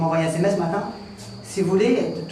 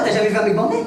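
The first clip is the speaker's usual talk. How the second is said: usual speaking voice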